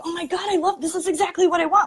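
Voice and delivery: high-pitched, thrilled gush; gushing excitement. Retold or imitated. Client raving to her web developer about his site concept, gushing excitedly. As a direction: imitate a thrilled client squealing with delight. Retells client's delighted reaction.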